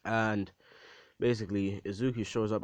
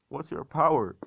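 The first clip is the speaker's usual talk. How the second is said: higher, louder astonished question; mother's astonished question; 6 semitones higher, 6 dB louder than usual. Retold or imitated. Mother asks returned son about his power, acted astonishment. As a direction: ask it high in acted disbelief.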